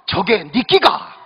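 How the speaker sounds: slow deadpan Gyeongsang dialect retort; Gyeongsang groom. Retold or imitated. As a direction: drag it out flatly like a gruff Gyeongsang husband. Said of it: "경상도 신랑이 하는 이야기가".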